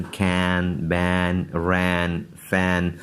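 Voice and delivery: कैन, बैन, रैन, फैन with a short ae; teacher reading a word list; model pronunciation. Shown as a demonstration. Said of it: "आवाज़ पढ़ी थी". (ऐ की आवाज़)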